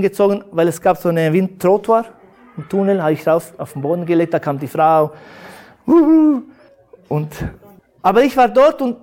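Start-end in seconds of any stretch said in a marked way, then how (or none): 5.9-6.4 high, loud little hoot; imitated agitated "uhu". Woman reaching the injured man, imitated high agitated cry.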